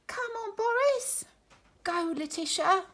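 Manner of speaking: high, eager cheering on. Acted. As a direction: urge the twigs on. Friends cheering the twig race.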